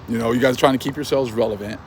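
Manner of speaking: normal talking voice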